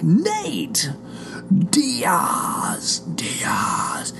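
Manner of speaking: high drawn-out announcer call fading to a whisper; imitating how fight announcers call Nate Diaz. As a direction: boom the name then breathe the last Diaz. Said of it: "the way they announce it".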